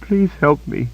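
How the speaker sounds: high, frightened repeated mumbling; high whimpering mumble; mumbled plea. A narrator's reading. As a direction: mumble a prayer for help over and over. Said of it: "she mumbled over and over".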